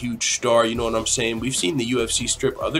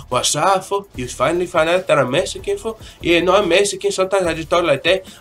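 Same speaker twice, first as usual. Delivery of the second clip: playful put-on Mexican-American accent; 3 semitones higher, 3 dB louder than usual. Vlogger answers a fan asking if he is Mexican, Mexican accent. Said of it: "in just my Mexican accent".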